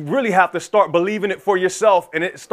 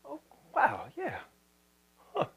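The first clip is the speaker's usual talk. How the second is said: very soft, high musing; second-time wonder; 7 semitones higher, 16 dB quieter than usual. Acted. Listener hearing it a second time, hushed wondering. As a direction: murmur it as it starts sinking in. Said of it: "Somebody say something the second time".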